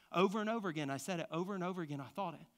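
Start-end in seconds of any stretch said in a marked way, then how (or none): none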